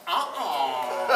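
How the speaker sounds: a loud, laughing uh-uh. Real, not acted. Actor laughing off the dubbed French line. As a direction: laugh it off with a scoff.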